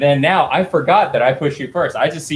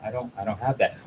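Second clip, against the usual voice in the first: calm; 9 dB quieter than usual. Acted. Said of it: "so you're just very calm".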